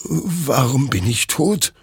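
high, slow, bewildered question; stunned, plaintive question; distressed disbelief. Acted. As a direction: ask slowly, lost and shaken. Hector finds his own corpse.

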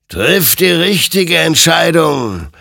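high, loud, drawn-out warning; admonishing. A narrator's reading. Rider warns Hektor to choose rightly, loud admonishing. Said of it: "ermahnte ihn sein Gegenüber".

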